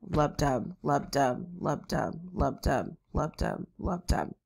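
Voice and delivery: steady spoken heartbeat rhythm; even two-beat pulse, softly spoken; lub dub heartbeat. Shown as a demonstration. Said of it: "you remember your S one and S two are".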